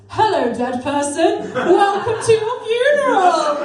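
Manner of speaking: put-on a delighted little boy calling out; child loudly welcoming the dead at a funeral, laughing; cheery child greeting. Son greets the hearse.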